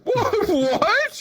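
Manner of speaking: high laughing what; baffled laugh. Retold or imitated. Players baffled by his moves, high laughing. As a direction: squeak it, laughing in confusion.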